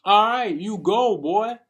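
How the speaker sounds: loud, high cheering shout; cheering on a friend with a loud high shout. Retold or imitated. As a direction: hype him up at full volume.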